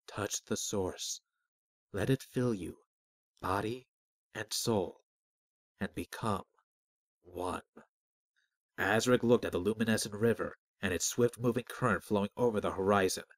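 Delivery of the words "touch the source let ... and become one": soft, halting invitation with long pauses; hushed, deliberate mystical voice; disembodied voice. A narrator's reading. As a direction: guide quietly, phrase by phrase.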